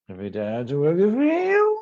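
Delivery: mock Australian with steeply rising upspeak; exaggerated Australian twang, climbing high at the end; Australian lingo. Co-host imitating the singer's lingo, mock Australian upspeak. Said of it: "He did that Australian lingo sort of thing".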